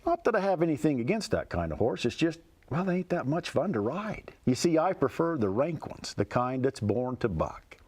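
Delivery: poem recited with a folksy lilt; cowboy poetry. Acted. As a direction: tell it like a rhyming cowboy yarn. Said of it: "It goes like this".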